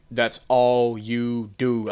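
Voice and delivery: drawn out, every word weighted; slow deliberate stress on That's all you do; slow emphatic repeat. Retold or imitated. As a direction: spell it out slowly. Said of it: "I was explicit with what I said".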